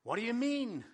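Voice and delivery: loud, high exclamation of disbelief; exclaiming. A narrator's reading. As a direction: raise pitch and volume in a baffled outburst. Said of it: "exclaimed Nicodemus".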